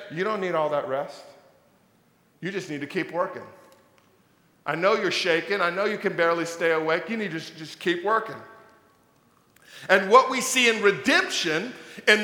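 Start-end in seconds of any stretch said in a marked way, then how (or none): 0.1-8.3 low, soft, fast urging to keep working; a quiet pressuring voice; voice of a pushy culture. Pretend. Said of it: "diminish it and say".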